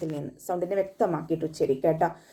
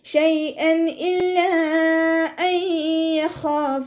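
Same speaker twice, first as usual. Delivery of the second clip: slow, high, drawn-out Quranic chant; measured tajweed recitation; 9 semitones higher, 4 dB louder, much slower than usual. Shown as a demonstration. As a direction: recite it in tajweed, stretching each sound. Recites verse between explanations.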